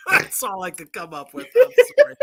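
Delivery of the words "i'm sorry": high, loud, breaking into laughter; laughing apology. Real, not acted. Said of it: "hard time keeping a straight face".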